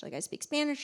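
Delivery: normal talking voice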